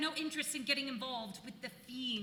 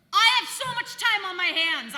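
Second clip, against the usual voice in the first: sarcastic outburst; 7 semitones higher, 13 dB louder than usual. Real, not acted.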